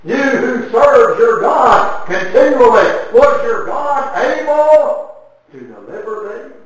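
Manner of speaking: high, loud and slow, calling into the den; crying out. Acted. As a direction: call out to Daniel in anguished hope. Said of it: "he cries out these words".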